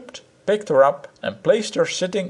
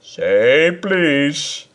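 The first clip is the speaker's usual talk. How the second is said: teasing; 3 semitones higher, 3 dB louder than usual. Acted. Husband teasing.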